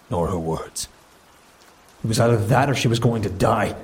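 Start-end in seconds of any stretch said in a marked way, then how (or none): 2.0-3.7 higher and louder, defending himself; a brother defending his choice to the council; defensive. A narrator's reading. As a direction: push back hard, on the defensive. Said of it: "I answered defensively".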